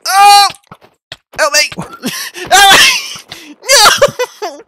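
high, loud screams for help; terrified screaming. Real, not acted. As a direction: shriek for help in panic. Screaming while grabbed by monster.

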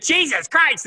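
very high imitated exclamation; exclamatory inflection. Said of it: "You have to have that inflection".

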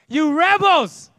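loud, high, drawn-out accusation; angry shout. Acted. Moses calling out the gathered people, shouting. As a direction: holler it at the crowd. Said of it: "he shouted".

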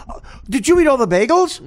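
high, loud mock sitcom question; sitcom character. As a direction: swing the pitch way up, hammed-up. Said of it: "Jennifer Aniston's character would come in and go".

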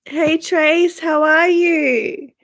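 ordinary talk

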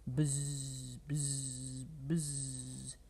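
low droning buzz; bee buzz. Acted. Reader voicing the bees in the story, low buzzing. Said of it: "That's what a bee says".

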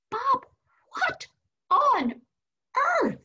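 mock-aghast, high-pitched, each word set apart; exasperated mom. Mom to her upset son, exasperated. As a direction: punch out each word in high, staged disbelief. Retold mother's shocked reaction.

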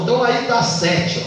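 ordinary talk, nothing marked

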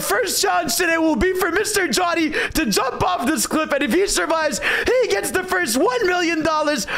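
high, hyped YouTube host voice; big energetic challenge announcement; Mister Beast intro. Acted. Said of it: "who wants to do the Mister Beast intro".

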